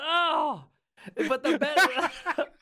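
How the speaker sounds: high, loud, slow laughter through the words; cracking up at being pranked; laughing hard. Real, not acted. Host laughing after falling for a prank link.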